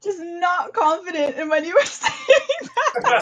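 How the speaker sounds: high, loud words breaking up with laughter; laughing. Real, not acted. Host teases the faltering bandmate, laughing hard. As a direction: crack up while talking, drawing the words out.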